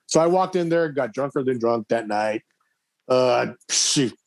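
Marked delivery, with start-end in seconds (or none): none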